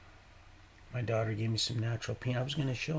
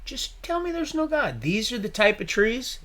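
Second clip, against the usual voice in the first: awed high exclaim; 12 semitones higher, 5 dB louder than usual. Real, not acted. The same words said twice.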